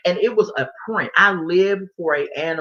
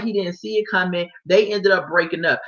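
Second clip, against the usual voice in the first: normal talking voice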